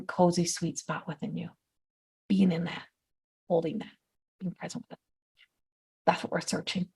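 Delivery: very soft, settling guidance; soothing hush. Real, not acted. Guide easing listeners into their cozy sweet spot, hushed. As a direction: let the voice sink into a calm hush.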